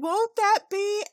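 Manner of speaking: high, slow character voice; high put-on voice. Repeating herself to deaf granny, high and slow. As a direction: stretch it out in a squeaky voice. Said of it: "I said".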